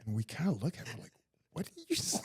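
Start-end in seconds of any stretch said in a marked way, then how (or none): 1.5-2.2 high-pitched mock disbelief; baffled. Friends reacting to his odd thank-you, baffled. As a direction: react in amused bewilderment, voice jumping up.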